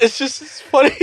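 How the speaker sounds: high, helpless giggling; on the edge of crying with laughter; laughing to tears. Real, not acted. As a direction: squeak it out through real laughter. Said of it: "Are you physically crying now".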